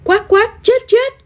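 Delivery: very high, loud hen alarm; terrified mother hen, pitched right up; panicked squawking. A narrator's reading. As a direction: squawk frantically for help. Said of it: "kêu thất thanh".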